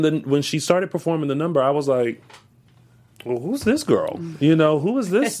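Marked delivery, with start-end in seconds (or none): 3.2-5.4 higher-pitched impressed double take; impressed reaction. Retold or imitated. Reacting to a surprising dancer, impressed. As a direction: act out being intrigued by a newcomer.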